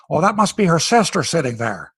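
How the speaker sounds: a light Irish lilt on the commentator's line; Irish accent. Retold or imitated. Imitating the Irish commentator in a light Irish accent. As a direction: put on an Irish brogue to mimic the TV commentator. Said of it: "with an Irish accent".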